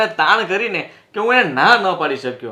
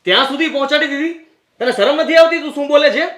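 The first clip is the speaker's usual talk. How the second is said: angry scolding; 6 semitones higher, faster than usual. Acted. Husband rebuking his wife's suspicion, indignant shout. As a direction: shame her with a raised voice.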